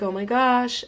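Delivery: ordinary talk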